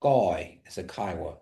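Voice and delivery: ordinary talk, nothing marked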